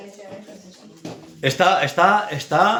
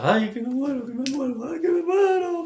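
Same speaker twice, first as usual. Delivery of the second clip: mock crying wail; 11 semitones higher, 4 dB quieter than usual. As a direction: imitate a tearful wail, high and softish. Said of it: "que me muero, llorando".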